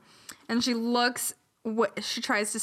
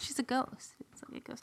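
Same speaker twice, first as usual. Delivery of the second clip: hushed, breath-only explanation; whispered aside; 3 semitones higher, 11 dB quieter, faster than usual. Real, not acted.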